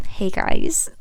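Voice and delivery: whispered hello; a slow hushed wave to the viewers. Real, not acted.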